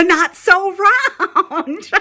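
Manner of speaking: laughing through the words, slowed. Real, not acted. Mom laughing while repeating her daughter's question. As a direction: laugh as you say it.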